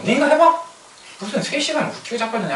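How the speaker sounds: hammed-up sarcastic grumble; mock scoffing. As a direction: scoff at the boss. Worker mocking boss's deadline.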